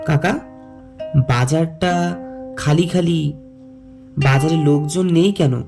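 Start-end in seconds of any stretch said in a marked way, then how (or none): none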